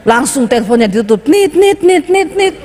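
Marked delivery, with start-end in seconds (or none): 1.3-2.6 imitated dial tone. Phone hung up, dial tone.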